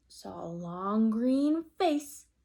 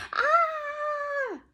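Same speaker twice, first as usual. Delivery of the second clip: high, loud pained yelp; shrill yelp; 6 dB louder than usual. Acted. Mother bitten on the nose, high loud yelp. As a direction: yelp like you've just been bitten. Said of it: "She yelled".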